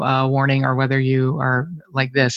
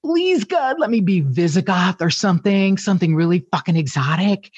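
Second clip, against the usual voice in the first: much higher, pleading mock prayer; excited prayer; 5 semitones higher than usual. Hoping her DNA kit shows something exotic, higher pleading prayer. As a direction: beg the heavens in giddy hope. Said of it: "I was so excited".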